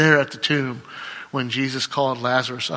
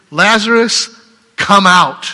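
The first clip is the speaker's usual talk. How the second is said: calling out; 5 semitones higher, 9 dB louder, slower than usual. Acted. Jesus calls Lazarus from tomb.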